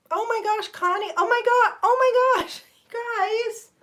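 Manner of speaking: high, loud delighted squeal; excited squeal. Real, not acted. Streamer squealing high and loud at a viewer's gift.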